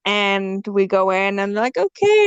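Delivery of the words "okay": much higher, bright sing-song okay; high mock-nurse okay; chirpy nurse okay. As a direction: play the cheery nurse. Nurse voiced in story.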